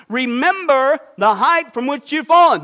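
loud, slow, solemn proclamation of the verse; reading scripture. Acted. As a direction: proclaim the verse slowly for all to hear. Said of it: "Here's what he says".

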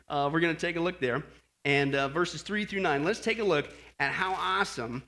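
ordinary talk, nothing marked

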